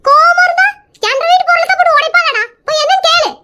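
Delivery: high-pitched, annoyed mother scolding her son; indignant scolding. Acted. Mother scolds son over smashing.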